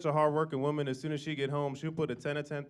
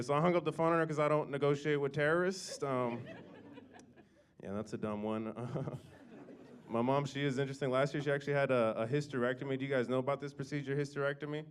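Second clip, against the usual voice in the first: ordinary talk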